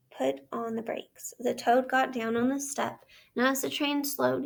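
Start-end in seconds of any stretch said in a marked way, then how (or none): none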